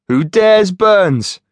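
slow, high teasing pun; mischievous. Acted. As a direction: draw it out with a wink. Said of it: "with a mischievous wink".

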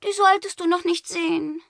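put-on high, alarmed girl's cry. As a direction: stop him in alarm. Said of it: "Gerda riss die Augen auf".

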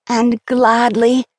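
slow, drawn-out glad cry; glad exclamation. A narrator's reading. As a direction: stretch it out with joy. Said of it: "Andrea cried".

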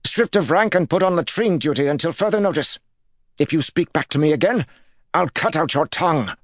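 high, shaky angry snap; trembling snap. A narrator's reading. Lord punishing his guard, trembling snap. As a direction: snap the order but let it tremble. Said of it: "but his voice trembled".

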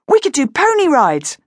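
high eager suggestion; excited. Acted. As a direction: pitch it eagerly.

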